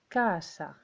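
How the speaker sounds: first A of casa drawn out; casa said as "caaa-sa", stressed vowel held long; stress on first syllable. Shown as a demonstration. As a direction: linger on the "ca" of casa, then a short "sa". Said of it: "elle est un petit peu plus longue".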